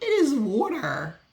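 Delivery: slow, pointed, leaning on is; exasperated retort. Retold or imitated. To rude vendors fussing over water, a slow pointed retort. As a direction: spell out the obvious with mock patience.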